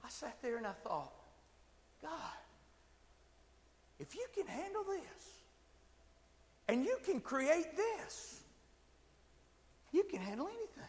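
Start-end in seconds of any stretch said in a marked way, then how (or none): 2.0-10.8 high, soft, slow wonder; hushed awe. Retold or imitated. Preacher retelling his thought to God under the waterfall, hushed awe. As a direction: address God softly, with long reverent pauses.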